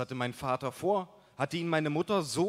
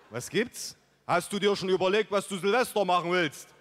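loud, higher put-on buddy voice; rustic buddy Mike; 5 semitones higher, 4 dB louder than usual. A narrator's reading. Buddy pestering his friend about New Year's, loud put-on voice. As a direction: play the pushy, rough-edged pal.

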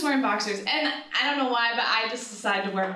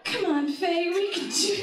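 high encouragement; 8 semitones higher than usual. Retold or imitated. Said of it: "I'm just like".

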